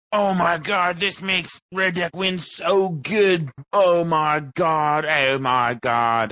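gushing mock fanboy, high and drawn out; hammed-up slow, excited raving; Lansdale voice. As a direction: drag out every word in over-the-top hype. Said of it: "That sounds like your Lansdale voice".

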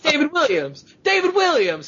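high squealed name, repeated; retold incredulous squeal; exasperated insisting. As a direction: drive the name home in a high, worked-up voice. Repeating name to baffled old man.

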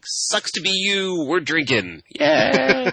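drawn-out, high teasing imitation of friends; mock gloating. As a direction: rub it in like partying friends, ending on a high cheer. Voices friends teasing him from afar.

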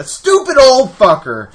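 loud high slow shouted curse; furious curse. Retold or imitated. Dad yelling at the old driver, a loud slow curse. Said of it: "And I go".

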